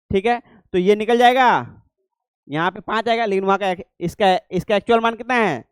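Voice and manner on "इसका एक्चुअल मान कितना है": normal talking voice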